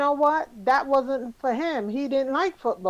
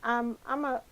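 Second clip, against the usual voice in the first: talking with a mouthful of food; words round a bite of food; mouth full. Real, not acted. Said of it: "I have something in my mouth".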